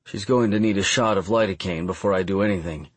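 plain reading voice

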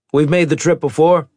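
stern captain voice. Acted. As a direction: voice the captain with authority. Maddox voiced.

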